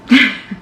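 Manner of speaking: a quick burst of laughter at her own joke; short real laugh. Real, not acted. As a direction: laugh briefly, caught out by the joke.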